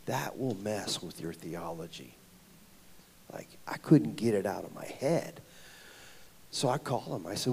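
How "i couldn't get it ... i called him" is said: normal talking voice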